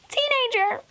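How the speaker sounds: very high-pitched scornful punch word; exasperated high squeal on the word; mocking emphasis. Real, not acted. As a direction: pitch it way up in mockery.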